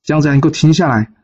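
a fellow disciple calling after him, building to indignant. Acted. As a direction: grow pressing with each call. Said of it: "后面声音越叫越急".

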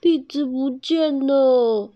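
high, slow mock wail; tearful whine. A narrator's reading. Bear reporting lost chestnuts, tearful whine. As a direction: whine it out as if crying. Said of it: "小修哭了过来".

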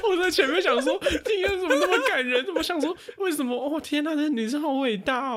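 very high, incredulous laughing speech; squeaky with laughter, mock-moved; laughing through words. Real, not acted. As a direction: gush in mock admiration while cracking up. Mocks being moved, cracking up.

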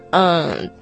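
normal talking voice

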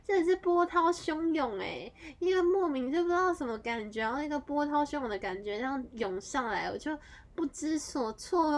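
high and loud, rushing with feeling; overwhelmed, excited retelling. Real, not acted. Telling friends about the shock of ten ghosts, high and loud.

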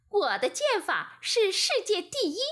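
high, elated storybook bragging; delighted boast. A narrator's reading. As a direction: crow proudly about your own skill. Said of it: "十分高兴".

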